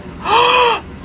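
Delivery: high, theatrical gasp; horrified gasp. Acted. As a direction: suck in air in mock horror. Horrified at the paper cut.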